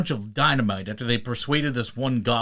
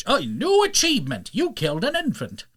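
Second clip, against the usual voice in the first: high, hammed-up achievement announcer; 10 semitones higher than usual. Retelling the book's achievement, mock announcer, high. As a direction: pop it out like a game notification.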